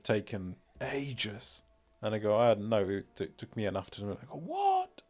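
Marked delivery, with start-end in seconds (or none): none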